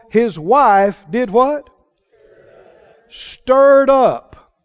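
loud, slow scripture reading; loud pulpit reading; preaching scripture. Acted. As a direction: proclaim the verse slowly.

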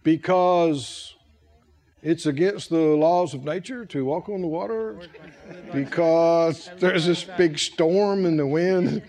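low, slow excuse-making voice; mock excuse. Preacher mocking Peter's excuse to Jesus, low and slow. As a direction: drone out the lame excuse. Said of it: "What would be the correct answer".

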